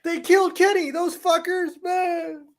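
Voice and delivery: very high squeaky cartoon voice; cartoon kid voice. South Park quote, very high.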